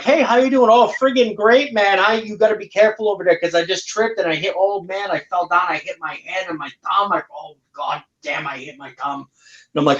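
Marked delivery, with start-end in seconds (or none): 0.0-0.7 cheerful greeting. Retold or imitated. S0 voices himself greeting a Mainer. 0.7-9.2 high, rambling Mainer voice telling of a fall; Maine accent impression. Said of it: "you go up there and you're like".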